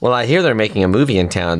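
usual speaking voice

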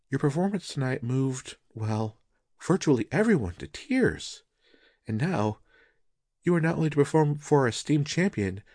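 gently pressing her husband, puzzled by his mood; concerned wife. Acted.

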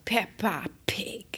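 hushed, slow whispered name; a slow stage whisper of the name. Pretend. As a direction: breathe the name like a secret.

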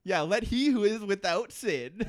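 high, loud mock sermon tone; mock preacher. Cohost riffing as preachy Jack, loud and high.

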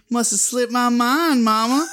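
sly imitation of a kid who got away with it; devilish. Kid excusing himself to Mama, devilish. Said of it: "devilish way".

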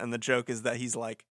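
ordinary talk

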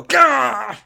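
short high "gah" outburst; sharp imitated yelp; imitated cry. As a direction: mimic a startled cry, high and sudden. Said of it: "you hear someone go".

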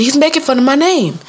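put-on high little-girl voice; complaining child. Little girl telling teacher about name-teasing, complaining.

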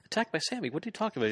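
high, incredulous squeak; shocked disbelief. Retold or imitated. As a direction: act stunned at the news, voice jumping high. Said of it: "He's like".

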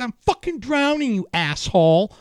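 high-pitched, exasperated protest; indignant retort. Retold or imitated. Drowning swimmer to the lifeguard, indignant retort. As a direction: act out a panicked, angry comeback. Retold shouting back at lifeguard.

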